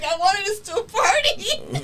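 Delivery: high, loud, drawn-out mock whine; cute whining. Retelling how he wanted to party, cute mock whining. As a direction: whine it like a pleading little kid, imitating him. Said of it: "It was so cute".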